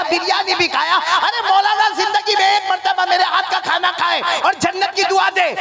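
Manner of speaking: high, building to a shouting scold; a wife berating her husband, rising to a yell; mock tearful rant, half laughing.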